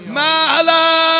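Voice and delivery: high, long-held mournful cry; wailing call. Acted. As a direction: call after someone leaving, stretching the word out. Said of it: "دید صدا ناله میاد".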